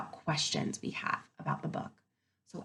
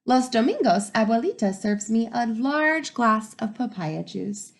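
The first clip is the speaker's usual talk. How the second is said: lively storybook read-aloud; reading to children; 9 dB louder than usual. Acted. Teacher reading to her class, lively and expressive. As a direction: bring the story alive for the kids.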